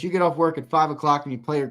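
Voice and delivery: ordinary delivery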